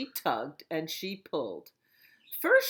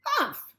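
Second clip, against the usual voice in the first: high, loud scornful humph; witch humph; 7 dB louder than usual. Acted. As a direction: snort it like an offended witch. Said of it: "said the witch".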